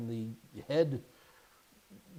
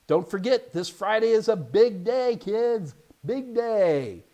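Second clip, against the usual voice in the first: higher, louder and slow big hype; excited announcement; 6 semitones higher, 5 dB louder, slower than usual. Acted. Host hyping the Friday guest to viewers, louder excited announcement. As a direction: build up the big day for the kids.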